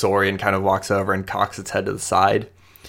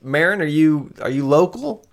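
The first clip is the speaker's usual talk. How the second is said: put-on higher, flustered young woman brushing off help; nervous Amoya; 7 semitones higher than usual. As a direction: stammer out that she's fine, pitched up. Amoya asks Marin.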